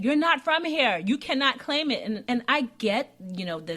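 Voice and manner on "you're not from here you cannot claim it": insistent, raised higher and louder; adamant, high and loud. Retold or imitated. Aunt insisting she cannot claim it, adamant and raised. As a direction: insist the point hard, pitched up. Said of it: "she was adamant that".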